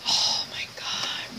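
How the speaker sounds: hushed, breathy oh my God; awed whisper. Real, not acted. Showing viewers beads from a friend, an awed whisper. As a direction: whisper it as if the beads might break. Marvelling at gift boxes.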